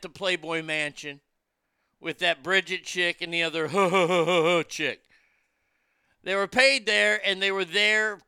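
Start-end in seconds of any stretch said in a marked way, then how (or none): 3.7-4.6 scornful laugh. Real, not acted. Mocking laugh mid-rant.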